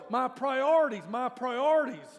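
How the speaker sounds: preacher mocks congregation's loud lament; mock exasperated cry. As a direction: ham up the exasperated whine of a busy believer. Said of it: "But we go".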